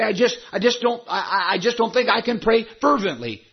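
stammered excuse. Acted. Churchgoer excuses himself from praying fervently, stammered excuse. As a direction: play a reluctant believer, tripping over the first words.

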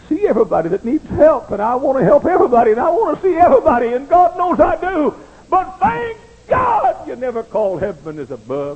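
loud, high, rushing into a shout; impassioned preaching. Real, not acted. Preacher confesses wanting to help everybody, loud and impassioned. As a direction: pile it up loudly to a climactic cry.

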